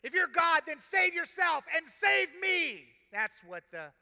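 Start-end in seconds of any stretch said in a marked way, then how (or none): 0.0-2.9 loud, high, sneering challenge that slows at the end; the first criminal taunting God, loud and scornful. Acted. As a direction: jeer at God with full scorn. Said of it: "Taunting God".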